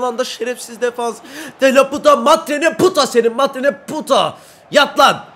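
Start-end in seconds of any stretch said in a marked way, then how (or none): 1.6-5.2 high, loud angry mock-Spanish cursing; angry swearing. Real, not acted. Gamer cursing the defender who blocked his shot, angry. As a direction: spit the fake Spanish curse out.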